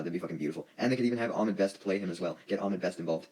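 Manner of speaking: ordinary delivery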